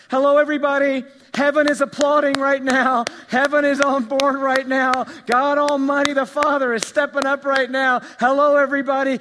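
loud, high preaching call to the crowd; full-force preaching. Acted. Preacher to congregation, loud rousing proclamation. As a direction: proclaim it like heaven is cheering.

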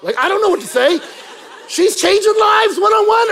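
high, shouted protest; hammed-up yelling; comic exasperation. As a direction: wail it loudly in mock despair.

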